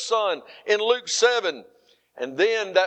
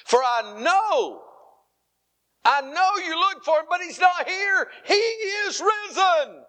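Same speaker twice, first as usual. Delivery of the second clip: lifted, proclaiming Easter preaching; 9 semitones higher than usual. Acted. Preacher proclaiming the risen Lord to the church, lifted. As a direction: proclaim it to the congregation.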